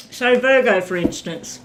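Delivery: ordinary talk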